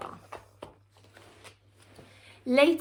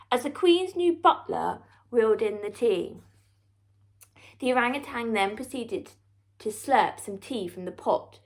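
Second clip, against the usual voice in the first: ordinary delivery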